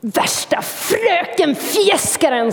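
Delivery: slow, sneering taunt; mocking jeer. Jealous hedgehog jeers at squirrel, slow mock sneer. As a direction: lightly jeer at the teacher's pet. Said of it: "sa han".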